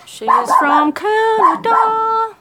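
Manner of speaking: reading the chat aloud in a silly slow voice; goofy put-on voice. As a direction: ham up the viewer's comment in a silly voice.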